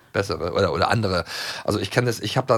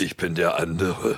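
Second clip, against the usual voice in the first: lower, slow Benjamin Blümchen voice; deep mock elephant voice; 5 semitones lower, slower than usual. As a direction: drop low and drawl like the cartoon elephant. Playful intro as second impersonator.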